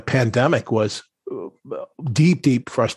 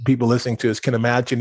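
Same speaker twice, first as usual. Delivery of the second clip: ordinary delivery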